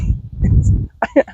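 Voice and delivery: helpless breathy laugh; guilty laugh. Real, not acted. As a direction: laugh though you shouldn't. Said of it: "I shouldn't laugh".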